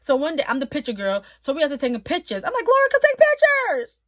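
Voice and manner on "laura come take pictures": high, loud call across the room. Retold or imitated. Picture girl calling [name] over for photos, loud high call. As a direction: call her over to the camera.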